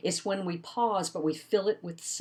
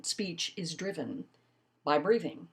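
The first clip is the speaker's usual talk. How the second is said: normal talking voice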